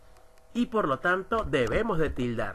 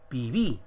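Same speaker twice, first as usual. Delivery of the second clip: Pibí with the weight on "bí"; stress on final syllable; 3 semitones lower than usual. Shown as a demonstration. Teacher listing aguda examples, Pibí stressed on "bí". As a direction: lean on the last syllable of Pibí. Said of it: "la mayor fuerza de voz en la última sílaba".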